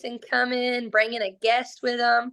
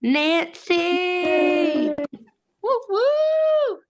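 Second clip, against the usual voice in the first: high whooping cheer; excited cheer; 6 semitones higher, 3 dB louder, much slower than usual. Real, not acted. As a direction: whoop the winner's name up high. Winner announced.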